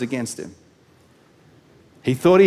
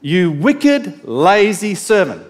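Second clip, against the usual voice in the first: stern rebuke; 4 semitones higher, 7 dB louder, much slower than usual. Retold or imitated. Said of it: "said to him".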